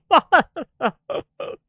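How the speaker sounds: high, loud cackle that bursts out; loud burst of laughter. Real, not acted. Streamer cracks up at his own innuendo, loud laughter. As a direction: crack up at your own joke. Joking about a working stiff.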